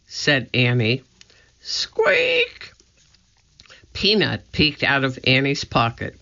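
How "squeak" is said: very high, thin squeak; tiny high-pitched animal noise; mouse squeak. A narrator's reading.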